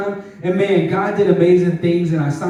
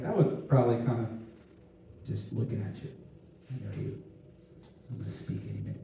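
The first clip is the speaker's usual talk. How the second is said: much softer, fading into a whisper; hushed whisper; 8 semitones lower, 17 dB quieter, much faster than usual. Retold or imitated. Preacher imagining the staring servant girl, whispering. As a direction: whisper as if watching him secretly.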